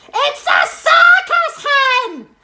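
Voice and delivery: high, loud, slow delighted shout; high loud old woman; Grandma shouting. A narrator's reading. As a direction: cheer the hen as Grandma. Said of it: "Grandma shouted".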